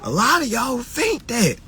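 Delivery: ordinary delivery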